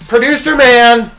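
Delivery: loud, high callout of the name; roast callout. Acted. Host calling out his producer, loud high callout. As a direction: hail him like a roast target.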